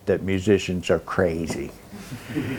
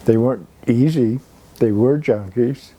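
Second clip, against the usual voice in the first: ordinary talk, nothing marked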